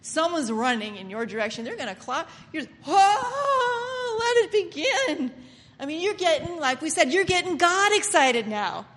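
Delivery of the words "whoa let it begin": high, drawn-out exclamation; awed excitement. Acted. Worshipper as the fire starts, high excited cry. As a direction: cry it out high, stretching the words. Acts out person seeing fire coming.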